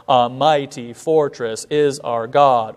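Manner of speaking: slow, weak-strong beat through our help in ages past; measured hymn line; iambic recitation. Shown as a demonstration. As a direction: recite with stately iambic stress. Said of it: "written in iambic patterns".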